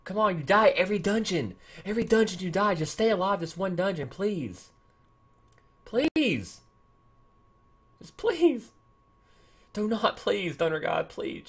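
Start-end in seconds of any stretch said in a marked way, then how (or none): 4.1-11.4 high, drawn-out begging; desperate pleading. Real, not acted. Gamer begging Thunder God to stay alive, pleading. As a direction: plead with rising desperation, then tail off. Begging hero not to die.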